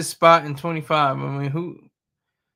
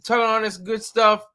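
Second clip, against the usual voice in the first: higher-pitched recruiter sales pitch; imitated recruiter; 5 semitones higher than usual. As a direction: pitch it like a recruiter selling the job. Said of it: "the recruiter's office".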